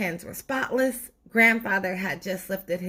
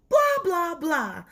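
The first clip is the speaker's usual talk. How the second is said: mocking blah blah; 10 semitones higher, slower than usual. Mocking the nagging tooth.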